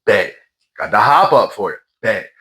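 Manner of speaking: usual speaking voice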